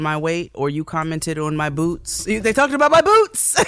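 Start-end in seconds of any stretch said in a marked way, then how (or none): 2.3-3.6 indignant. Retold or imitated. Indignant retelling.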